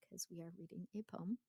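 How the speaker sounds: a quick whispered aside to the class. Real, not acted. Teacher to class, a quick whispered aside. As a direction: drop to a whisper for a sly side comment.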